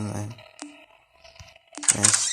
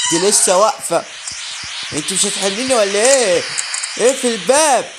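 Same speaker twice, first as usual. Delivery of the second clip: raised, loud voice, climbing in pitch; alarmed outburst; 5 semitones higher, 6 dB louder than usual. Real, not acted. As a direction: complain loudly in mounting alarm. Monster still blocks the door.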